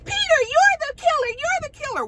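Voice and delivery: high, wailing mock panic; mock dramatic panic. Kenya mocking the guessing girls, high wailing shriek. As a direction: wail it like a hammed-up horror victim. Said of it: "she was like".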